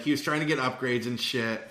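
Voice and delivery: usual speaking voice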